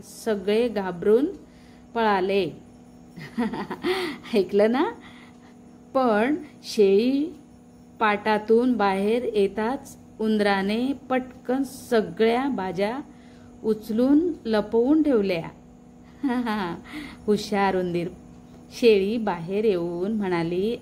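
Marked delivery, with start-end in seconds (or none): 3.2-4.3 soft chuckle between sentences; storyteller's giggle. Acted. Storyteller amused at the animals fleeing, soft giggle. 16.2-17.2 short, soft chuckle; storyteller's giggle. Acted. Storyteller amused by the clever mouse, soft chuckle.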